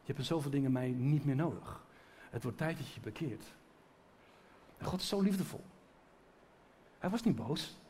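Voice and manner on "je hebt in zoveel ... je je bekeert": soft, hushed and low, like a loving rebuke; a quiet correcting father; God's gentle voice. Retold or imitated. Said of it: "Hij zegt". (zacht en liefdevol berispend)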